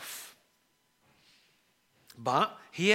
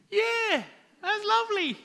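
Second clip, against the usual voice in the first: sigh, then a much higher, overly bright yeah that's lovely; forced enthusiasm. Retold or imitated. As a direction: fake delight to hide frustration. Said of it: "you're just thinking".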